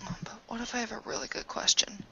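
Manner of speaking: hushed, breathy plea in a whisper; a secretive whispered question. Acted. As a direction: whisper it so only she hears. Sneaky whispered plea to contact.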